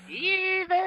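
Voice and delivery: very high, squealed mock cry; mock shout. Host echoes the shout of a fleeing character, high mock cry. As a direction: send it way up in playful alarm.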